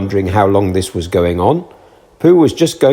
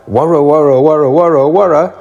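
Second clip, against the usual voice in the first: loud worra; 4 semitones higher, 4 dB louder than usual. A narrator's reading. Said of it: "with one loud".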